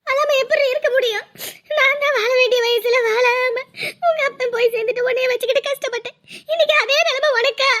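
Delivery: acted crying, words dragged out through sobs; tearful lament. Mother grieving that her daughter shares her fate, tearful. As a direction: lament your hard life through tears. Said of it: "எதுக்கு இப்ப நீ அழுதுட்டு கிடக்குற".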